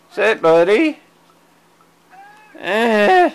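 high, loud sing-song to a cat; pet talk. Real, not acted. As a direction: coo up at the cat to get his attention. Talking to her cat.

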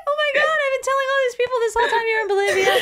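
high, fast, laughing shout as an astonished mom; mock mom. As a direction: rattle it off as a mom caught out. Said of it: "She's like".

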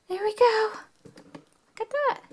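high, soft delighted coo. Acted. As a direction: marvel gently. Delighted at placed piece.

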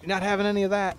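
high, quick dog voice; talking dog. Acted. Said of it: "dog's like".

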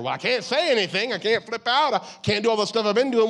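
imitating someone bottling up anger; frustrated believer. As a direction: vent like a trapped, fed-up person. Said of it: "what do you do with all that anger".

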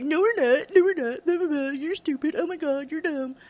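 high, loud whiny imitation of critics; mock childish protest. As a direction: throw a silly mock tantrum. Mimicking critics answering him with insults.

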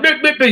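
mock electronic beeping, high and punchy; imitated mic glitch. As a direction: mimic a broken mic in short beeps. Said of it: "Your mic is not".

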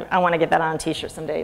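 usual speaking voice

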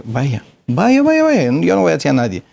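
high, hurried retold brush-off; arguing dismissal. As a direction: send them off impatiently, mock quarrel. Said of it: "una pelea". (despedida de pelea, imitada, aguda y rápida)

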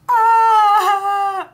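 high loud mock crying wail; mock sob. Imagining herself as a mother crying over her kids. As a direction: wail like a weepy parent. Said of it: "is just gonna be like".

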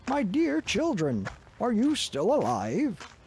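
a lightly voiced worried schoolmaster; anxious old schoolmaster. A narrator's reading. Schoolmaster checks on the children under the bat, lightly voiced anxious. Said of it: "the schoolmaster said".

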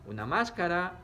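normal talking voice

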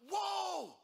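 high, loud whoop of hallelujah; elated father crying out hallelujah; echoing praise shout. Acted. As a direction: shout in sudden awe so the house rings. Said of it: "it echoed through the house".

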